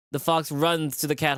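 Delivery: normal talking voice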